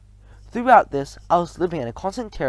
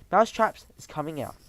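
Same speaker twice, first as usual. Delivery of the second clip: ordinary delivery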